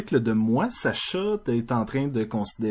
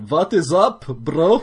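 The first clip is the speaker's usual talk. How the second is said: put-on German accent; 3 semitones higher, 4 dB louder, much slower than usual. Mock German greeting.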